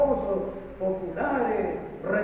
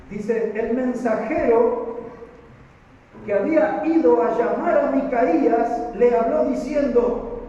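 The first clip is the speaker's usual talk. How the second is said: loud, slow, raised scripture reading; preaching at full force; 5 semitones higher, 6 dB louder, slower than usual. Acted. Preacher reading the messenger's words to the church, loud and slow. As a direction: proclaim it slowly to the congregation.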